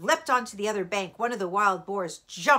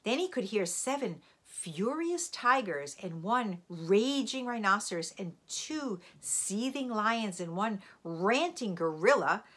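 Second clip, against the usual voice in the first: ordinary delivery, nothing marked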